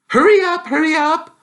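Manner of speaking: high, loud urging; shooing the boys onto the train; mother hurrying. Acted. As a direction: mum calling them to hurry. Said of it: "their mother said".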